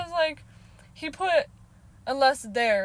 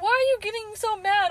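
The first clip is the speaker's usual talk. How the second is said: taunting; 10 semitones higher, 5 dB louder than usual. Retold or imitated. Taunting the commenter.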